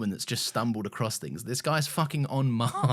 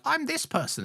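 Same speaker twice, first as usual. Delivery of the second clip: much higher put-on voice; high mock voice; 14 semitones higher than usual. Character revealing themselves, much higher voice. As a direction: squeak it up high. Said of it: "they were like".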